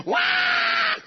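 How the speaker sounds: imitated newborn wail, high and loud; mock baby wail at birth; baby's cry. Preacher imitating a newborn spanked by the doctor, high wail. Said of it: "you go".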